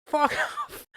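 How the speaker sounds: high retort breaking into laugh. Retold or imitated. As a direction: laugh through the curse.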